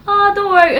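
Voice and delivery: an eager, forgiving "Oh, don't worry"; reassuring reply. Acted. Acted reply to apologetic name request.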